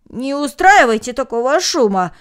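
loud hushing call; loud call to stop the noise; exclaiming. A narrator's reading. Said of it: "воскликнул молодой человек".

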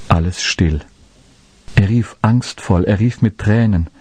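usual speaking voice

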